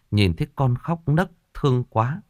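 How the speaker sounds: usual speaking voice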